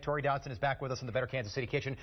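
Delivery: normal talking voice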